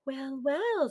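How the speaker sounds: sweet smile. A narrator's reading. Oonagh greeting the giant, sweetly smiling. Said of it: "with her sweetest smile".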